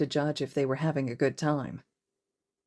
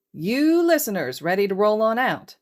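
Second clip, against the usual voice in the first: high, loud radio-host call; loud, high, upbeat call to the waiting kids; cheery call-out; 6 semitones higher, 6 dB louder than usual. Acted. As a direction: hail the kids like a DJ. Hizashi hails the kids.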